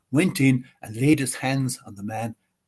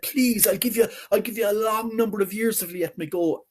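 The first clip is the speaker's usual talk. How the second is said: high desperate begging; high pleading; 8 semitones higher than usual. Acted. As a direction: plead shrilly to be freed. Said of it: "he started shouting".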